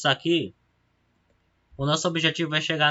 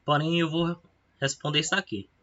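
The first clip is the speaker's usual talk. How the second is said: hiccuping between words; hiccups. Real, not acted. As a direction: talk through the hiccups. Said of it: "Ainda tô com soluços".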